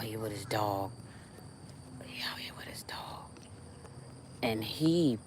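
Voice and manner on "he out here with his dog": a secretive whisper about the neighbor; hushed whisper to the viewers. Real, not acted. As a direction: whisper it so he can't hear. Repeats it whispering about the dog.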